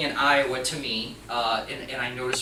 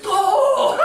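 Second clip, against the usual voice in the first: high, loud burst of laughter; imitating his laugh; 8 dB louder than usual. Clerk caught skipping a step, bursting out laughing. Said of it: "and he's laughing".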